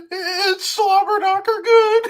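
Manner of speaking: high, slow drawled catchphrase; J R impression. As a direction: ham up the announcer's catchphrase. Imitating J R catchphrase.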